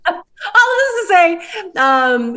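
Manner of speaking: very high, slow laughing squeal; laughing too hard. Real, not acted. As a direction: squeeze it out through helpless laughter. Regains story while still laughing.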